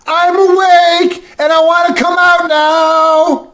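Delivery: put-on high, slow child's call; sing-song like a small child; toddler calling. Three-year-old calling from her room at dawn, high slow child voice.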